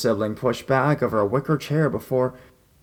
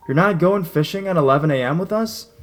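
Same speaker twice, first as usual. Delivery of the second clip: shocked question, higher and louder; surprised gasp; 7 semitones higher, 5 dB louder than usual. Retold or imitated. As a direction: jaw drops, she can't believe it. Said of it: "with wide eyes and surprised gasps".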